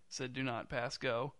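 ordinary delivery

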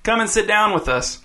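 high, thin, slightly whiny invitation; thin whine, pitched well up. A narrator's reading. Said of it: "thin and a little whiny".

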